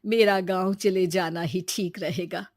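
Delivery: put-on elderly grandmother voice; resigned old woman reasoning with her grandson. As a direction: gently refuse as an old grandmother.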